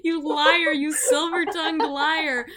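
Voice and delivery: high, loud indignant protest; loud, exasperated high-pitched wail; flustered mock outrage. Real, not acted. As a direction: shout down the teasing compliment. Mock outrage at teasing compliment.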